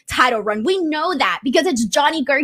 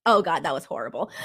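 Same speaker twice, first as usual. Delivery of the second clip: embarrassed laugh; 8 semitones lower, 6 dB quieter than usual. Real, not acted. Embarrassed after own impression.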